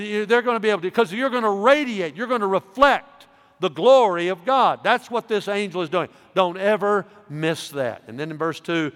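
usual speaking voice

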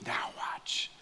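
breathy whisper, "Now watch." with no voice at all. Retold or imitated. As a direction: whisper it as the lions creep to the bank. Guide whispering before lion hunt.

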